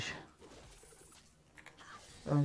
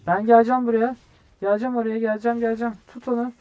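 higher, excited repeated calls; urgent callout; 7 semitones higher, 6 dB louder, faster than usual. Real, not acted. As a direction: call out that help is coming. Calls out while rushing to fight. (heyecanla, telaşla seslenme)

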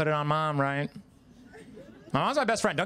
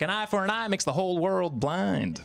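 higher-pitched Arkansas twang; Southern twang; 4 semitones higher than usual. Acted. Gandhi from Arkansas preaching to supporters, Southern twang. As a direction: put on a Southern accent for a wise saying. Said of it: "what if Gandhi was from Arkansas".

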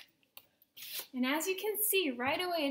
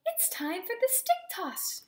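high, eager event call; announcing; 8 semitones higher than usual. A narrator's reading. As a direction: announce the next game. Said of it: "she announced".

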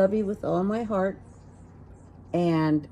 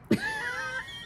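a quick airy laugh, much higher; breathy high giggle; 8 dB quieter than usual. Real, not acted. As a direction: giggle at the punchline. Laughs at her punchline.

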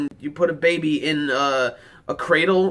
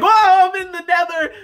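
mock freak-out; 12 semitones higher than usual. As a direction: imitate the captain freaking out in fear. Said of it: "freaking out when they first entered".

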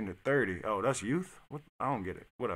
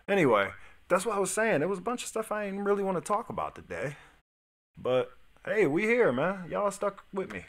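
fast, loud and high hype to the viewers; energetic streamer shout-out; hyped up; 10 semitones higher, 6 dB louder than usual. Real, not acted. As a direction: pump up the audience.

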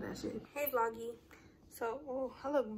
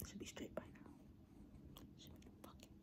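whisper; 25 dB quieter than usual. Real, not acted.

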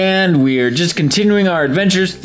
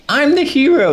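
much higher, mocking young hero; high squeaky hero voice; 11 semitones higher, 3 dB louder than usual. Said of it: "And N's like".

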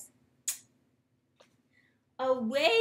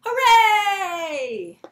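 big shouted hooray, higher and louder; loud cheer; 8 semitones higher, 9 dB louder than usual. Shown as a demonstration. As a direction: cheer it out like you just won. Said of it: "Let's say hooray together".

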